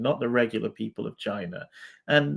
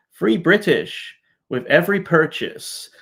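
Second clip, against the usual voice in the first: Free British sold like a cheesy ad slogan, a bit louder and higher; mock advert pitch; 5 semitones higher, 4 dB louder than usual. Mocking the slogan for his viewers, as a cheesy ad. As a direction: read it like a product jingle.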